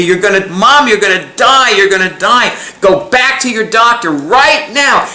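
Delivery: high-pitched mock yell, retold secondhand. Son yelling at his mother to go back, high mock yell. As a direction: pitch it up and half-yell the son's panic. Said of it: "He was yelling at me".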